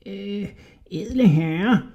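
high, piping, hushed rat voice; squeaky whisper. A narrator's reading. Little man hushing to Hodja, high and piping. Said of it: "hviskede den lille mand med en pibende stemme".

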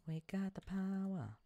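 high, proud cry; triumphant. Real, not acted. Gamer cheering as crops get watered, triumphant. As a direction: belt it out like a victory line.

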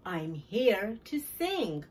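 ordinary delivery, nothing marked

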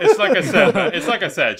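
said through a loud laugh. Real, not acted. Agreeing with a friend about the show, laughing loudly. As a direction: laugh while saying it.

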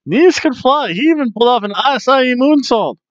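high-pitched, thrilled recap; high-flying enthusiasm; excited marvelling. Real, not acted. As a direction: rave about the move in a high, excited voice. Marvels at wrestler's flying move.

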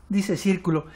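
usual speaking voice

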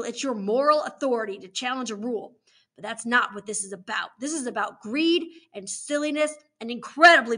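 forceful moralizing authority; stern warden, scolding. Acted. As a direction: reprimand children with punched key words. Warden lectures the children.

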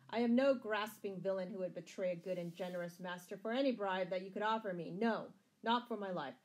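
loud, proud, ringing declaration; ringing tones. A narrator's reading. Scarlet defying the sheriff before the crowd, ringing. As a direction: project it boldly to a whole crowd. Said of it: "the reply came in ringing tones".

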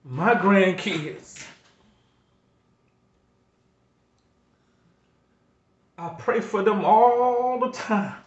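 pleading, close to tears, pushing through; emotional. Real, not acted. Grandfather on his channel, overwhelmed thinking of his kids, emotional. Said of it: "I get a little emotional".

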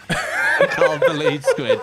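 laughing disbelief. Real, not acted. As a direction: crack up in disbelief. Amazed at friend still flying.

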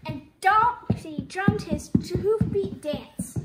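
ordinary delivery

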